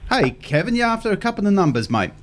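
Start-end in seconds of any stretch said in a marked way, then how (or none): none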